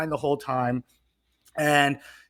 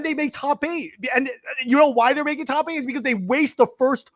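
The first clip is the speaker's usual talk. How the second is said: high, loud outraged rant; exasperated rant; 9 semitones higher, 4 dB louder than usual. Real, not acted. Complaining to friends about the judges, loud and outraged.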